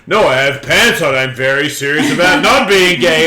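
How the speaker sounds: loud, hammed-up mock protest. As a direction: play the porn actor protesting too much.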